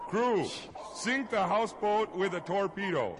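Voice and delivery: loud high captain's command, "Crew" called out; shouted order. Acted. Captain redoes the order to his crew, a loud high shout. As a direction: bellow the full order across the deck.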